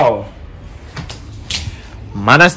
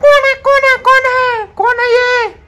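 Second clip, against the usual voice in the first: very high-pitched pretend panic; hammed-up frightened squeal; mock fright. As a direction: squeal as if badly scared, playfully. Acting scared by child's scare game.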